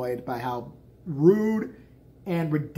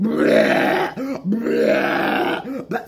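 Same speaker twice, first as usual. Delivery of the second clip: retching imitation; 6 semitones higher, 8 dB louder, much slower than usual. Her retching answer.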